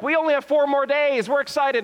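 put-on high, loud childlike glee; excited kids. Kids counting paper chains before Christmas, high excited cheer. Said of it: "their excitement is growing".

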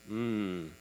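low, soft "mmm" of relish; savouring hum. Real, not acted. Preacher remembering fresh baked cookies, soft savouring hum. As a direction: hum softly as if tasting it.

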